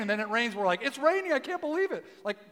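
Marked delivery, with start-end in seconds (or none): 0.8-2.0 high-pitched, put-on amazement; mock disbelief. Preacher mimicking locals when the rain comes, mock disbelief. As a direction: jump up in pitch, imitating astonishment.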